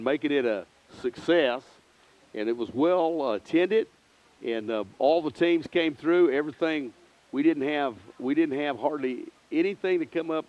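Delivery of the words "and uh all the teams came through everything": normal talking voice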